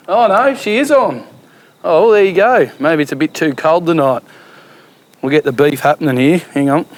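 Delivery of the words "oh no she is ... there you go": surprised. Real, not acted. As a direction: caught off guard, pleasantly. Record player turns out on.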